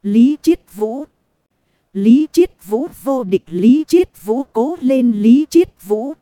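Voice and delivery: loud fangirl chant; cheerleader. Acted. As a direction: shout his name like a cheering fan. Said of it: "quay sang hò hét cho Lý Chiết Vũ".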